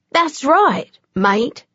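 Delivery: put-on English accent, high and deadly; slow English voice, lethal calm. A narrator's reading. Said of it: "The accent was English, and the tone was lethal".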